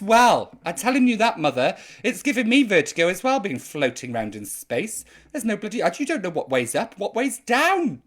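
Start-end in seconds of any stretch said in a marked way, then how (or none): none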